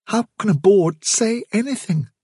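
put-on high, puzzled young voice; bewildered young rabbit. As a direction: ask it in frightened disbelief. Fiver, bewildered.

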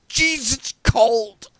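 high, loud, drawn-out cry; shocked yelp at cold. Acted. Rescuer stepping into a freezing shower, yelping. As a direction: yelp as freezing water hits.

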